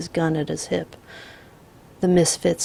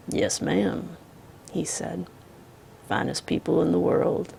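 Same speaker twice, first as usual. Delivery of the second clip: quiet menace under politeness; calm drawling outlaw; 4 dB quieter than usual. Acted. The Misfit voiced.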